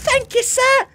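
high squeaky parroting; mock boy's thanks. Streamer parroting little Tommy's thanks, squeaky mimicry. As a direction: mimic a grateful boy in falsetto.

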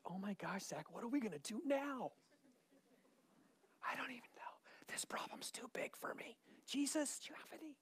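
hushed, whispery fake panic; imitation of panicking under the breath; mock freak-out. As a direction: whisper in pretend helpless alarm. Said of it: "how God never freaks out".

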